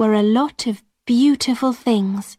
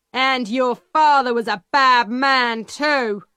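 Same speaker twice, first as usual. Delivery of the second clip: scornful peddler; 2 semitones higher than usual. A narrator's reading. Peddler insulting Alison's family.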